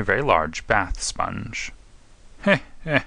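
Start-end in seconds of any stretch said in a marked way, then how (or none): 2.4-3.0 amused inspector's acted chuckle, higher pitched; chuckling. Inspector teasing Holmes over the bath sponge, chuckling. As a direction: chuckle lightly into the words. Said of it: "chuckled the inspector".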